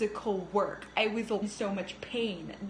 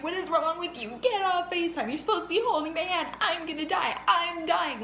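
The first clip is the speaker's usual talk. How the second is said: high, louder frantic plea; retold crying yell; 8 semitones higher, 3 dB louder than usual. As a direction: relive yelling at mom in labor. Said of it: "I'm crying, like".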